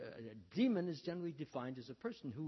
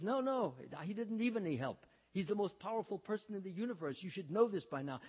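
higher, louder acted protest; emphatic objection; 6 semitones higher, 5 dB louder than usual. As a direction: argue back firmly, pitched up and loud. Lakshman argues with Sita.

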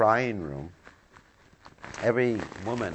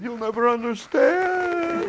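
high, slow, loud whining wail; mock wounded queen; 7 dB louder, slower than usual. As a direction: ham up the sulking queen's cry. Queen's sulky retort, acted.

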